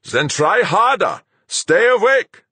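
high, loud, forceful shout; urgent command. A narrator's reading. Fuchs to the pilot fighting to stay conscious, urgent. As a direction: shout to keep them awake.

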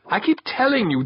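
high-pitched, fed-up reminder; exasperated. A narrator's reading. Boss reminding his men of his method, exasperated. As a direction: stress it like a tired repeat.

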